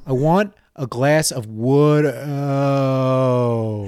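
slow, drawn-out Delco "wood-ohh"; exaggerated slow local pronunciation; slowed-down repeat. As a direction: spell it out slowly, stretching the last vowel of "wooder". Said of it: "when I slowed it down".

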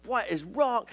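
high-pitched mock yell without real volume; retold yelling. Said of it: "I was screaming, I was yelling".

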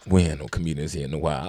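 low-pitched, downbeat delivery; low deadpan excuse. Retold or imitated. Host excusing the room to the comic, low deadpan. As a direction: drop the voice low and say it matter-of-factly. Retold host's muttered excuse.

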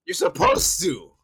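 high, loud, drawn-out objection; exasperated insistence. Real, not acted. Co-host telling friend to call him out, exasperated.

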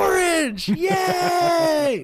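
put-on very high, loud, squealing kid voice; excited little kid. Man imitating the boy who got his room back, high squealing kid. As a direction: squeal like an overjoyed nine-year-old.

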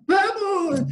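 high, drawn-out keen cheer; eager toddler intonation. Acted. Church brother replying to an invitation, imitated toddler eagerness. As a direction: answer like an over-eager little kid. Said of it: "é essa a entonação do corpo de Cristo".

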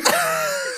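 loud, high-pitched cackling laugh; high laughter. Real, not acted. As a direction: burst out laughing. Cracking up at own joke.

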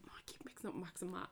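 soft unvoiced whisper to herself; whispered aside. Real, not acted.

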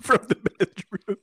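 squeaky high repeat, slowed; high mock echo. Teasing echo of China listening.

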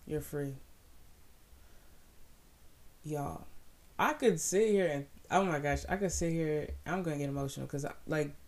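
ordinary talk

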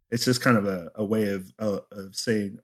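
ordinary talk

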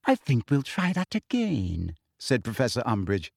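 sugary teacher voice. Acted. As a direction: lecture sweetly like a prim official.

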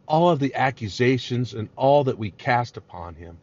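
ordinary talk, nothing marked

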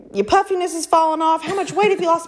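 high-pitched mock compliments; imitating gushing admirers. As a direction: gush like an amazed girlfriend. Admiring people voiced in her story.

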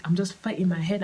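ordinary talk, nothing marked

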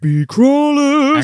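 high, slow, drawn-out title call; announcer voice. Acted. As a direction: boom the title like a promo. Echoing title in a big voice.

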